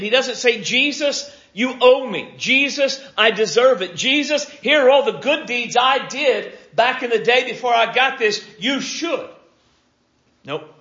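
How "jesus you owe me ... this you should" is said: high, insistent mock demand; hammed-up entitled demand in a raised voice. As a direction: press your claim like you are owed. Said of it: "He doesn't make a demand".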